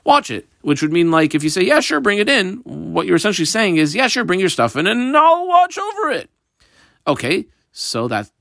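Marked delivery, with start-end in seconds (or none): 1.6-2.6 high, easygoing neighbor saying yes; breezy agreement. Acted. Voicing a neighbor agreeing casually. 3.9-6.3 high-pitched, happy-to-help neighbor; obliging promise. Acted. Voicing the neighbor's implied promise.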